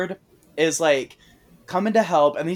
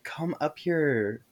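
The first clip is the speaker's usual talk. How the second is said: creepy robot Beth; slower than usual. Retold or imitated. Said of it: "fake Beth is like".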